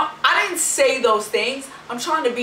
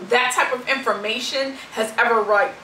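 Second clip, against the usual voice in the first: usual speaking voice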